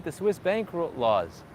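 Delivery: usual speaking voice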